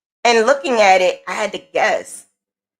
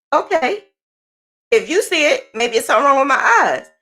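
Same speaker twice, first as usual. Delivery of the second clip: lightly voiced grandma, higher pitch; imitated grandma; 4 semitones higher, faster than usual. Grandma doubting her own eyes, imitated higher voice. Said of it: "And she goes".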